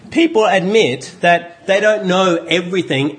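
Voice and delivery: normal talking voice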